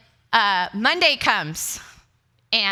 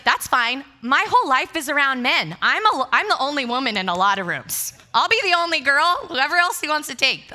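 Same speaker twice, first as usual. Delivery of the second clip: loud, raised-pitch retold insistence; playing up a defiant plea; insistent protest; 5 semitones higher, 6 dB louder than usual. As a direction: argue your case loudly.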